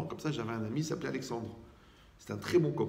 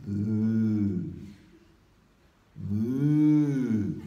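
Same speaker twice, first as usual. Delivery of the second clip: stuffy-nose voice; 5 dB louder than usual. Shown as a demonstration. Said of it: "On l'appelle le bœuf quand on est enrhumé".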